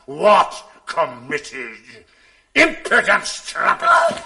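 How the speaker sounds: roaring, slow, then screaming high; furious shout. Acted. Jealous husband screams insult at wife, furious. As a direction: explode at her with full rage.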